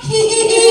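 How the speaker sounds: high, loud mock giggle; imitated giggle. Lecturer imitates laughter in TikTok clip, mock giggle. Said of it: "Heeh, ketawanya juga".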